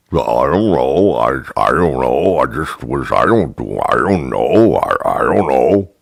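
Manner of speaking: low, dopey bartender playing dumb; stupid voice. Bartender to complaining customer, dopey low voice. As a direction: mumble like you know nothing. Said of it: "talking in that stupid voice".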